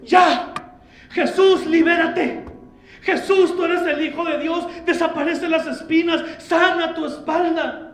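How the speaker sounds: high, loud pleading cry; inner cry. Acted. Mary's heart crying out to her crucified son, loud and pleading. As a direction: cry out to him to free himself. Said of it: "que él por dentro gritaba".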